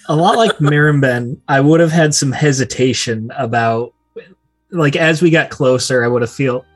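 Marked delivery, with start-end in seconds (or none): none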